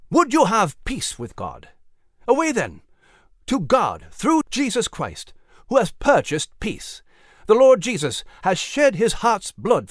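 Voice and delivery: put-on fervent old-time sermon voice; preaching. A narrator's reading. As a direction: preach it to a packed field. Sermon remembered.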